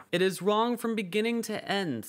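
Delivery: decided. A narrator's reading. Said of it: "said the caterpillar decidedly".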